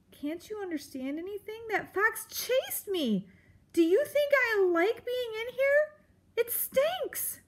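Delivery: high, fed-up little mouse voice; exasperated mouse. A narrator's reading. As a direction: whine it out in frustration. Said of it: "an exasperated Poppy replied".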